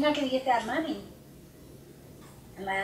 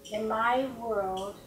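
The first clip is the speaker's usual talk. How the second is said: ordinary talk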